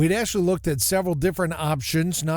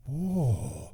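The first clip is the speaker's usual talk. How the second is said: hushed awe; 5 semitones lower, 6 dB quieter than usual. Retold or imitated. Amazed reaction.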